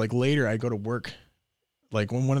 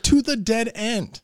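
slow, higher, louder deadpan jab; sarcastic; 12 semitones higher, 8 dB louder, slower than usual. Retold or imitated. Mocking his friends for following him, slow and loud. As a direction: spell it out slowly with mock patience. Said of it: "I was like".

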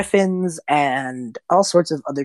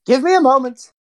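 Mel voiced; 10 semitones higher, 4 dB louder than usual. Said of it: "Mel looks up".

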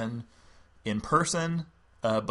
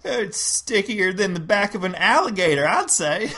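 high, loud Southern belle voice; Southern lady voice; 8 semitones higher, 6 dB louder than usual. Acted. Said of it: "role play Carolyn and another overlord".

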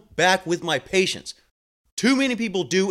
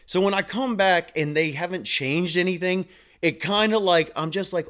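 ordinary talk

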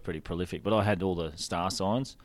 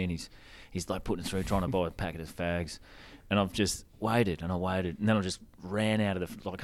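ordinary talk, nothing marked

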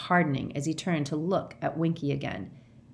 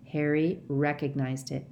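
usual speaking voice

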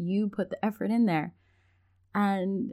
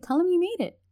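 much higher, louder eager push; a mom nudging her shy son, excitedly; eager urging; 12 semitones higher, 4 dB louder than usual. Retold or imitated. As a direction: urge him on excitedly.

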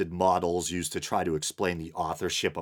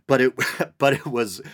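laughing; 6 semitones higher, 5 dB louder than usual. Real, not acted. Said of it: "what is wrong with me?".

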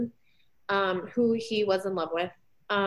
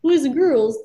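put-on higher, louder, sing-song kids' show host; Barney host voice; 6 semitones higher, 4 dB louder than usual. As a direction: sell it like a cheery children's TV host. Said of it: "like, a Barney episode".

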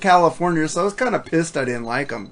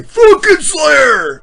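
much higher, very loud, slow drawn-out scream; fan scream; 12 semitones higher, 8 dB louder, much slower than usual. Retold or imitated. Imitating Slayer fans at a show, screaming the band name. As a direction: howl it like a crowd of metalheads. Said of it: "all you Slayer fans say".

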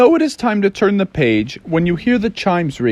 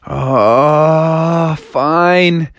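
long grudging sigh of agreement; mock sulky giving in; reluctant Froggy. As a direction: draw out the groan, then give in. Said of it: "And Froggy was like".

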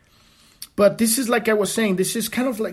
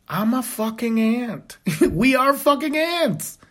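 slow, much higher, laughing through the words; 8 semitones higher, slower than usual. Real, not acted. Speaker laughing at human smallness, slow and high. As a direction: laugh at how tiny we are.

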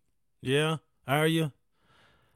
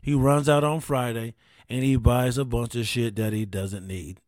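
usual speaking voice

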